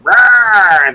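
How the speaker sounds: loud, high imitated roar; mock roar. Retelling how Facebook reacted to the insult, mock roar. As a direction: burst out with a big cartoonish "rawr". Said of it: "and it was like".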